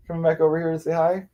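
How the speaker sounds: high, cooing question; pet talk. Real, not acted. Owner greeting the returning cat, high cooing pet talk.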